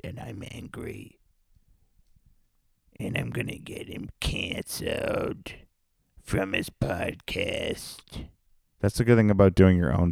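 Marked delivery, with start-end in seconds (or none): 0.0-8.2 sulky mock whisper. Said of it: "like some of you fucking nerds".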